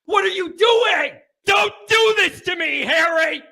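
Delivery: high-pitched, exasperated yell; yelling about football. Real, not acted. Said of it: "good to just yell about football".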